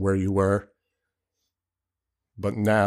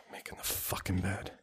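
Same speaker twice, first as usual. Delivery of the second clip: disgusted sighing mutter; 12 dB quieter than usual. Real, not acted. Sighs in disgust.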